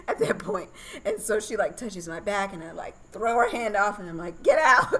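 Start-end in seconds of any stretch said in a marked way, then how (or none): 4.4-4.9 sharp yell, much higher and louder; a real-sounding yell to get away; shouted get out. Retold or imitated. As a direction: bark it out in the heat of labor. Yelling at midwife during birth.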